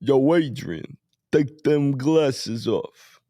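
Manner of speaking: slow, drawn-out boxer impression; lumbering mock boxer voice; Rocky. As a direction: imitate a punch-drunk movie boxer, dragging the words out. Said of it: "you wanna hear Rocky?".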